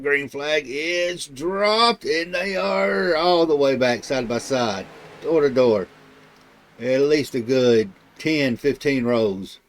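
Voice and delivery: loud, drawn-out green-flag race call; live play-by-play. Real, not acted. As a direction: call the start big, stretching the key words. Calls the race start live.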